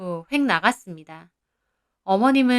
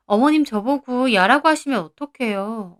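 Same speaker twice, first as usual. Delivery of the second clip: plain reading voice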